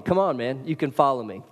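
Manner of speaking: ordinary delivery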